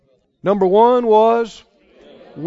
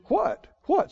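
high, quick squeak of 'what?'; startled inner voice; 8 semitones higher, 7 dB quieter than usual. Acted. Head answering the faith confession, startled. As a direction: play a baffled head interrupting, high-pitched. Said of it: "And your head goes".

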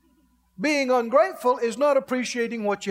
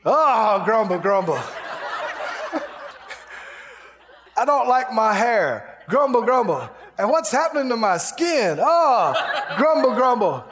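hammed-up grumbling. Stubbing a toe on a child's toy, hammed-up complaining grumble.

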